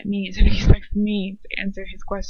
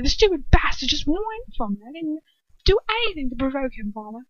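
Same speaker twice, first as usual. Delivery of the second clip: indignant protest; 6 semitones higher, faster than usual. Acted. Draco protests to father.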